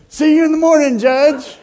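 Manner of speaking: high, loud farewell to the judge; arrestee calling out. Retold or imitated. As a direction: cheerfully shout it over your shoulder from the police car. Drunk arrestee calls to the judge.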